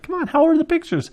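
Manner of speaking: very high, exasperated begging; acted-out desperate prodding; impatient pleading. Retold repeated question, impatient for answer.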